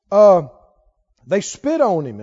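ordinary talk

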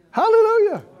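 high, ringing hallelujah with a lift of joy; joyful hallelujah. Real, not acted. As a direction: let the praise burst out up high. Preacher exults after the scripture.

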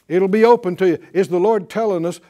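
ordinary talk, nothing marked

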